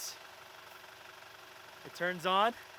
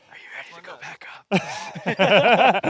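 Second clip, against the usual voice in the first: laughing whisper; 10 dB louder, much slower than usual. Real, not acted.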